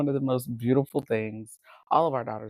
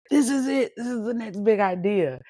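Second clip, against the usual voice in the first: excited big idea; 8 semitones higher than usual. Retold or imitated. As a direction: sell it like the next big thing. Past self's excited idea.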